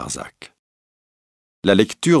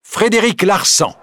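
much higher, louder triumphant reveal; young man revealing the murderer to the court, triumphant; 12 semitones higher, 4 dB louder than usual. Acted. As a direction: announce the name in triumph. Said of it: "d'une voix triomphante".

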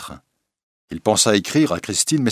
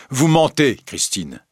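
higher and louder, dropping on the name; angry accusation; 7 semitones higher, 4 dB louder than usual. Acted. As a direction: hurl the accusation at her. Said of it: "Christine se laissa injurier".

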